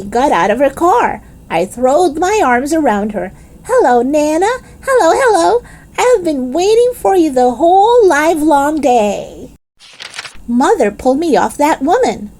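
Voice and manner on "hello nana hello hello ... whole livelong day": gleeful. Acted. Child greets Nana gleefully.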